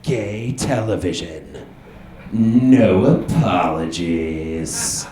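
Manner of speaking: low, slow ad voice-over; mock-solemn slogan, drawn out; slogan announcer. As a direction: read it like a bold tagline, word by word. Reads bus ad slogan as announcer.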